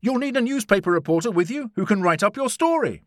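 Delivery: high, loud eager pitch; keen young volunteer; excited cry. A narrator's reading. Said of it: "I cried excitedly".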